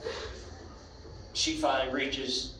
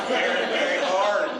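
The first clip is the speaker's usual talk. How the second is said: words through a laugh; 2 semitones higher, 3 dB louder than usual. Real, not acted. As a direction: chuckle the words out. Laughing at own joke.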